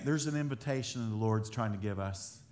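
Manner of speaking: ordinary talk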